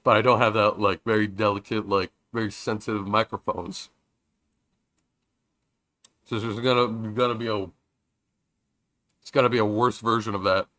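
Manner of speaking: talking while chewing; words round a bite of burrito; mouth full. Real, not acted. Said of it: "I'm talking with my mouth full".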